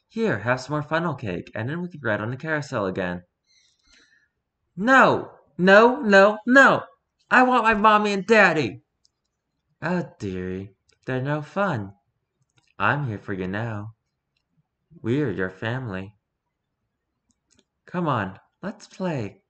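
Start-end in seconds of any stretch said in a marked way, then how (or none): 4.8-8.8 put-on louder, higher protesting child; crying tantrum. Little girl refuses to play, loud tantrum. 9.8-19.4 lower, softer sinister sweet-talk; creepy coaxing friend. Acted. [name] coaxes the crying girl, low and sinister.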